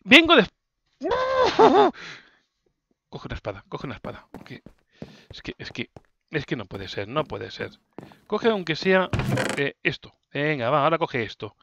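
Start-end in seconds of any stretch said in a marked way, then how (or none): 3.1-7.7 softer muttering under breath; softer self-talk; muttered to self. Real, not acted. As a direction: mumble it to yourself. Muttering to himself in frustration.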